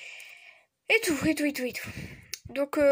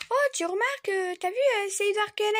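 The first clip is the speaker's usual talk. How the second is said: higher, excited mother's voice; imitating mom; 7 semitones higher, faster than usual. Mother pointing out Edward Cullen, mock excited. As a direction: gush like mom did. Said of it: "Ma mère, un jour, elle m'a dit".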